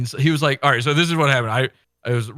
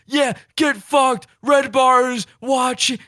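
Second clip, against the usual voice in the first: high, slow, loud mock yell; sneering hang-up; 12 semitones higher, 4 dB louder, slower than usual. Mocking the caller's parting threat before hanging up, high mock yell. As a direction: shriek the threat like a cornered coward. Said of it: "And I said".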